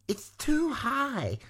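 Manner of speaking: high, slow wail; frightened. A narrator's reading. Lavender looking down at the moat, high scared wail. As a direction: draw it out in high fear.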